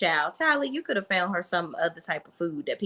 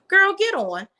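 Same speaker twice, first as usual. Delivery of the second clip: dismissive; 11 semitones higher, 5 dB louder than usual. Real, not acted. Dismissing Karen's cravings.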